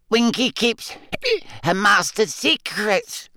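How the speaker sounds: very high, slow, hiccup-broken squeak; defiant tipsy elf refusing to tell; mutinous drunk elf. A narrator's reading. Winky defiantly refuses Harry, hiccuping. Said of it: "mutinously swaying".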